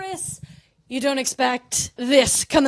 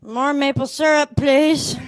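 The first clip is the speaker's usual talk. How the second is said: hammed-up sleep-talk getting louder; mock sleep-talking; 6 dB louder, slower than usual. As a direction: blurt it as if dreaming. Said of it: "wildly patriotic in my sleep".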